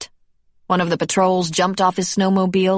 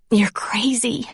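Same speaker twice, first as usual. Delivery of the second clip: higher, hushed whisper; 6 semitones higher, slower than usual. A narrator's reading. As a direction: whisper back in disbelief. Sarah replies in hushed tension.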